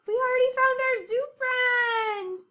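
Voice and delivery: thrilled discovery, high and loud; excited gasp. Acted. Teacher thrilled to show the kids the zoo friend.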